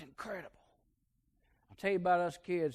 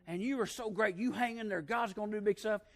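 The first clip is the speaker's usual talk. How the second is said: lightly voiced, higher tender old lady's blessing; loving old aunt; 6 semitones higher than usual. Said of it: "And she say".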